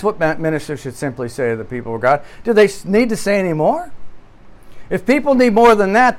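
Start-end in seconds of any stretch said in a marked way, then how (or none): none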